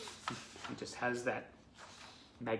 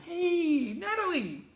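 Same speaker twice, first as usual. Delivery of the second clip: very high, drawn-out sung-out hello; excited shout-out; 10 dB louder, slower than usual. Real, not acted. Host greets letter writer Natalie, excited shout-out. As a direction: hail her from afar in a soaring pitch.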